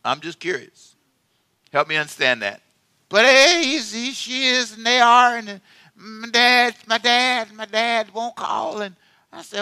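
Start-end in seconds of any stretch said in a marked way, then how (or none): 3.1-8.8 higher, slow mock tearful voice; imitating a crying counselee. Preacher mimicking a tearful person in counseling, higher and slow. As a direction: whimper it out like someone in tears. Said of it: "and say".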